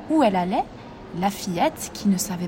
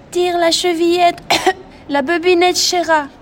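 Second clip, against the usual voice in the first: high, loud granny cry with a cough; sick grandmother calling out; 8 semitones higher, 7 dB louder than usual. A narrator's reading. Sick grandmother shouting to her visitor, high and coughing. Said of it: "La grand-mère malade lui cria".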